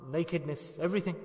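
ordinary talk

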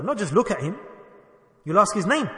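ordinary talk, nothing marked

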